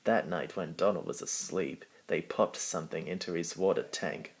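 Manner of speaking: usual speaking voice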